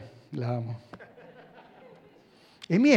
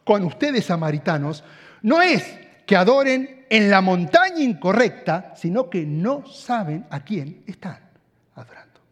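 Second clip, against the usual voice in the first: a firm raised voice, fading to a whisper at the end; voicing Jesus; 4 semitones higher, 8 dB louder than usual. Preacher voicing Jesus as he confronts the Samaritans, firm then hushed. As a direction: press the point hard, then drop away. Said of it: "es como si él estuviera diciendo".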